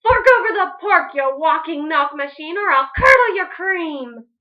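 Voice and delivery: loud threatening demand; angry witch. Acted. Witch Grinch threatening the cow, loud.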